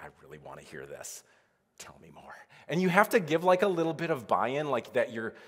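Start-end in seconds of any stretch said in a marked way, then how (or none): 0.0-2.3 much softer and lower, leaning in for the gossip; hushed gossip eagerness. Pretend. Listener begging a coworker for more gossip, hushed and eager. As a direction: drop right down as if sharing a secret.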